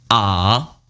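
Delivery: low AH that dips, then turns up; turning third tone. Shown as a demonstration. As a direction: dip "ah" low and bring it back up. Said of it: "Third tone turning".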